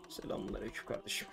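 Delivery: hushed, breathy mock greeting; whispered joking salaam. Real, not acted. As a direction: whisper it like a secret hello. Mock greeting mid game.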